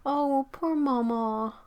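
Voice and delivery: high, slow, drawn-out sympathy; real sadness. Real, not acted. Feeling for the poor mama cat, upset. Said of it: "I got to the sad part".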